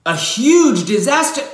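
high, loud burst of emphasis; big exclaim. Acted. Narrator exaggerating the disaster, suddenly high and loud. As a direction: blow it up big.

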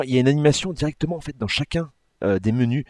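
normal talking voice

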